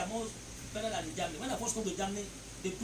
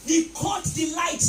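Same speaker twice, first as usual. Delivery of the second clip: preaching shout; 5 semitones higher, 6 dB louder, slower than usual. Real, not acted. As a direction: shout it out slowly to the church.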